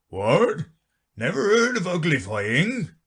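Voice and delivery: the Gryphon can't believe it; exclaimed. Acted. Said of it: "it exclaimed".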